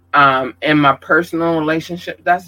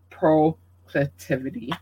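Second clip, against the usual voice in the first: normal talking voice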